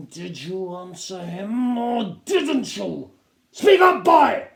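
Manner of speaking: slow menace rising to a high, loud roar; press, then explode; captain demanding an answer; building shout. A narrator's reading.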